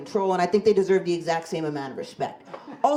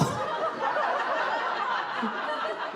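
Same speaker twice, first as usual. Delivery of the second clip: shrieking burst of laughter, much higher; high squealing laugh; 5 dB quieter than usual. Real, not acted. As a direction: cackle up at your own punchline. Big laugh at own joke.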